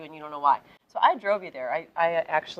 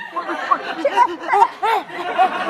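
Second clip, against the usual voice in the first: high, bright burst of laughter; delighted laugh; 10 semitones higher, 4 dB louder than usual. Real, not acted. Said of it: "you're so good".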